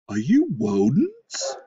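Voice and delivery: slow, loud, stuffed-up moose; lisping moose. A narrator's reading. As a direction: drag out the question, r turned to w.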